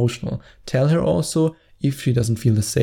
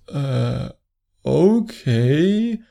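awkward shocked okay; 2 semitones higher, much slower than usual. Retold or imitated. Girlfriend shocked at confession.

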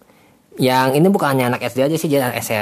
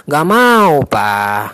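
put-on whiny child saying no; child's refusal; 2 semitones higher, 4 dB louder, much slower than usual. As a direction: refuse like a stubborn kid. Child refusing in a role-play.

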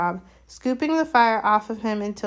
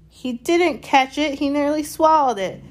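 howling protest; 6 semitones higher, 5 dB louder than usual. A narrator's reading. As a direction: howl it in protest.

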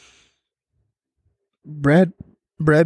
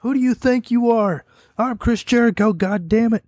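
a hint of an angry Jericho, pitched higher; mock berating; 6 semitones higher than usual. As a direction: scold him lightly in a raised voice. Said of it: "Jericho's berating him".

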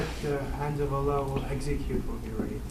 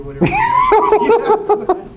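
shouting it out through laughter; laughing shout; 16 dB louder, slower than usual. Real, not acted. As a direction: blurt it loud, laughing. Student blurts answer, laughing.

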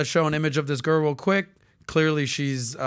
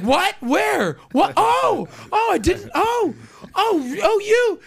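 very high, louder feigned shock with stammering ohs; mock innocent surprise; 4 dB louder, slower than usual. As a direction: play dumb, squeak out the oh's. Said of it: "And I was like".

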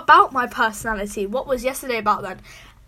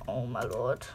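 low, soft muttered disbelief; under-the-breath exasperation; hushed dismay; 13 semitones lower, 10 dB quieter, slower than usual. Real, not acted. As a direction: drop to a low, quiet aside. Aghast aside after outburst.

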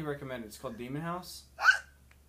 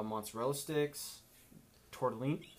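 usual speaking voice

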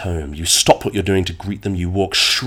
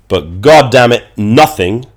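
frustrated outburst; 4 semitones higher, 10 dB louder, slower than usual. Acted.

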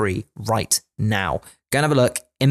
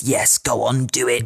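sly tempting devil voice; evil inner voice. Retold or imitated. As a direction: egg him on like a devil on the shoulder. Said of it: "the evil voice in the back of my head".

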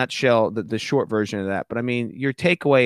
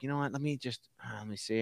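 scheming aside; 12 dB quieter than usual. Retold or imitated. Quietly scheming to himself.

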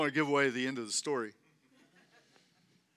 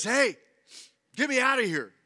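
higher, louder cry for help; urgent calling out; 8 semitones higher, 5 dB louder than usual. Acted. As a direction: call out as the snake demanding rescue. Snake pleads with the farmer.

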